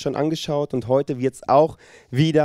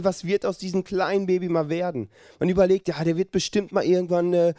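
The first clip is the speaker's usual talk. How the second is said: usual speaking voice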